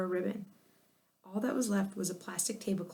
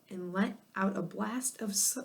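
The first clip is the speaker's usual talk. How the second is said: ordinary delivery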